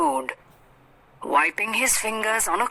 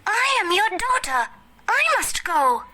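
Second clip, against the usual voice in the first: daughter shouting. A narrator's reading. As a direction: pitch it way up like an eager young daughter. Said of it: "The daughter shouted".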